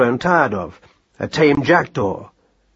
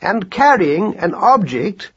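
high, slow, precise report; slow formal observation, pitched up; pedantic scholar mouse; 7 semitones higher, slower than usual. A narrator's reading. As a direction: spell it out like a learned little mouse. Voices Marcus Aurelius.

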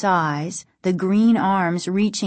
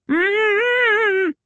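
high, long gagged hum; muffled pleading; 13 semitones higher than usual. A narrator's reading. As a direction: plead with mouth taped shut. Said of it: "their father continued to plead".

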